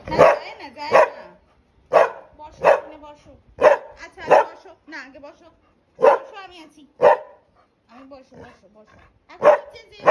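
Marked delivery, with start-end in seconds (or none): 0.1-8.3 firm loud commands. Real, not acted.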